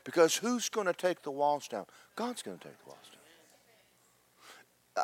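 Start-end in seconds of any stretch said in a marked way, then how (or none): 2.1-5.0 hushed whispered answer; confiding whisper. Real, not acted. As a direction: whisper it like an assured secret. Preacher answers own question confidentially.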